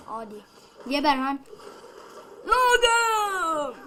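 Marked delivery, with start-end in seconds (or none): 2.5-3.7 much higher, loud crying-out of the name; anguished shout. Real, not acted. Gamer seeing his hero fall, shouting Logan in despair.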